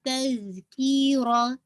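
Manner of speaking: तज़कीरा very slow, the z sound running on; continuous sound. Shown as a demonstration. Teacher shows the sound must keep flowing, slow recitation. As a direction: stretch तज़कीरा without cutting the sound. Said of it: "आवाज़ चलती रहेगी, रुकेगी नहीं".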